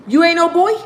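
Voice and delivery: put-on high, loud disbelieving kid; mock kid voice, squeaking up at the end; little boy. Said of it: "one little boy".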